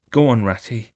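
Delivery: ordinary delivery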